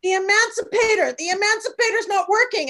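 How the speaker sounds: high, loud frantic cry about the emancipator; husband yelling that the emancipator's not working; panicked malapropism. Retold or imitated. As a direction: shout the wrong word in full panic. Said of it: "So he's like".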